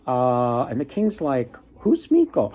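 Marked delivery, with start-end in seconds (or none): 1.8-2.5 a sudden high-pitched baffled question; puzzled high king. Retold or imitated. King asking the fox about his master, high puzzled voice. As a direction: squeak it up, baffled.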